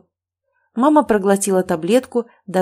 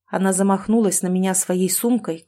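ordinary delivery, nothing marked

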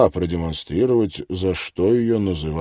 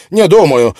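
furious editor; 9 semitones higher, 11 dB louder than usual. Acted. Angry editor.

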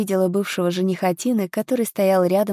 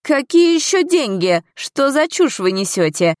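indignant retort, starting high and loud then dropping; an official's irate, dismissive bark; 7 semitones higher, 4 dB louder than usual. A narrator's reading. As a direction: snap back at the accusers. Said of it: "рявкнул градоначальник".

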